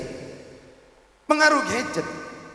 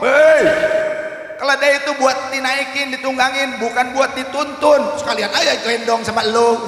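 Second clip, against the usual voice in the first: loud jeering call, louder on Hei; mocking villager shout; 3 semitones higher, 5 dB louder than usual. Villagers heckling the pair leading the donkey, loud mocking shout. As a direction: heckle them from the roadside, loud.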